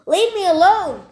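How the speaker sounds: high, loud frightened shout. Acted. Stephanie shouting at the man outside, loud shout. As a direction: yell to drive him off. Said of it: "Stephanie shouted".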